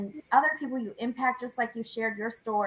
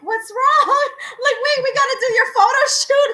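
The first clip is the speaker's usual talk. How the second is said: much higher and louder, laughing through the words; laughing retelling; 13 semitones higher, 9 dB louder than usual. Retold or imitated. Retelling the client about to cry at her reveal, laughing loudly. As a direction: laugh while playing your startled self. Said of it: "And I'm like".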